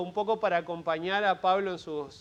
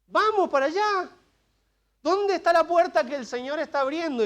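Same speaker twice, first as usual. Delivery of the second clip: much higher and louder, urging everyone on; rallying call; 8 semitones higher, 5 dB louder than usual. Real, not acted. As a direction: fire up the church to go. Preacher rallies congregation to go. (llamado entusiasta, mucho más agudo y fuerte)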